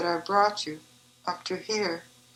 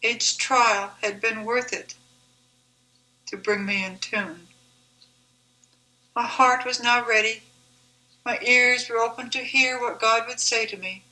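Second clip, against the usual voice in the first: usual speaking voice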